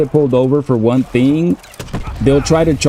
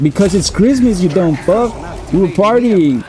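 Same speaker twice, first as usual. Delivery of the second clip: higher, mildly heated comeback at the cop; retold drunk retort; 6 semitones higher than usual. Drunk teen mouthing off to the cop, in a mildly heated higher voice. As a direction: snap back at the cop, half-joking.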